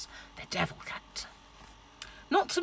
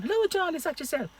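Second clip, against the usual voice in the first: put-on high sing-song Irish accent; lilting Southern Irish hello; 9 semitones higher than usual. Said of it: "gorgeous accent".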